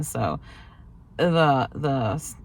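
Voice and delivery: usual speaking voice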